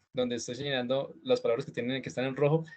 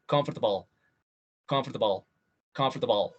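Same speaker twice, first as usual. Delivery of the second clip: comfortable rushed as comf-ter-ble; fast comfortable; 2 semitones lower than usual. Shown as a demonstration. Teacher saying Robert's version at full speed. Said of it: "a toda velocidad".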